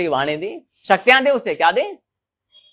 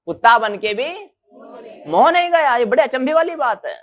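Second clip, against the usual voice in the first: high, emphatic amazement, rising well above usual; astonished; 6 semitones higher, 3 dB louder than usual. Retold or imitated. Sage amazed that attachment survived rebirth as a dog, astonished. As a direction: repeat it in raised-voice disbelief. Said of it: "Narad मुनि भी अचंभित".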